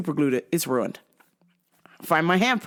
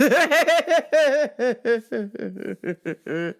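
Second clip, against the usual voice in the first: cackling laugh; 3 semitones higher than usual. Real, not acted. Storyteller laughing at the pump in pieces, long cackle. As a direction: burst out laughing, then wind down. Cracks up at own joke.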